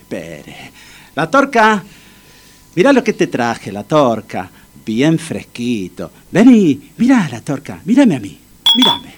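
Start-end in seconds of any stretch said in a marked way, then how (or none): 1.1-1.8 high rousing call. Acted. Friend trying to revive his pal, calling out. 6.3-9.1 high, insistent coaxing; urgent calling. Acted. Friend reviving his pal with a drink, urgently calling.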